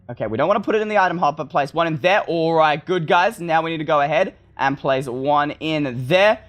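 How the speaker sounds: normal talking voice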